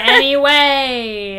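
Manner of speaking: slow, stretched anyway through a laugh; drawn-out anyway. Acted. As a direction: drag the word out, laughing.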